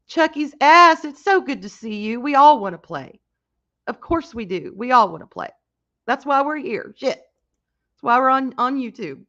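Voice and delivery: light up for the viewers; upbeat hello to the chat; excited greeting. Real, not acted.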